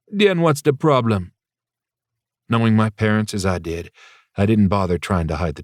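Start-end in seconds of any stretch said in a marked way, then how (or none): 0.1-1.3 put-on Jamaican accent. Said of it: "her lyrical Jamaican accent".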